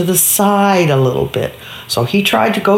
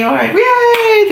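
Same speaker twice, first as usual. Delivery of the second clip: crowd cheer; 14 semitones higher, slower than usual. Acted. Crowd cheers the fallen rider.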